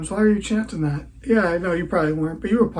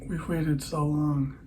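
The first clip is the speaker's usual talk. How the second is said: a tongue-in-cheek documentary hush; mock dramatic hush; 7 dB quieter than usual. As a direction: build suspense like a hammed-up trailer. Mock dramatic build-up intro.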